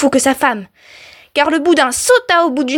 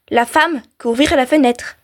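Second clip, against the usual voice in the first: ordinary delivery, nothing marked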